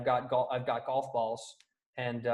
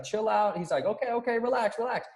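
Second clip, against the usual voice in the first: higher, hammed-up brushing-off voice; mock calming; 8 semitones higher than usual. Imitating Tim Raines telling the kid to relax, mock calming. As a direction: wave the kid off in a lightly voiced mock calm. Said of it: "And he's like".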